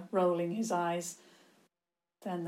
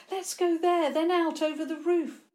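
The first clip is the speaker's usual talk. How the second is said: high, loud, hurried plan; urgent; 10 semitones higher, 5 dB louder than usual. A narrator's reading. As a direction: urge them on in a high voice. Fleeing girl urges escape.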